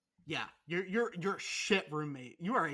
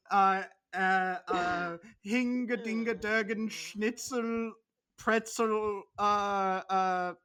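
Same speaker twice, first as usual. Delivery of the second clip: louder, hammed-up fake German words; mock German babble; 5 dB louder, much slower than usual. Imitating German Robert with mock German babble. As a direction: babble German-ish words in a silly accent. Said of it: "it's like he's here with us".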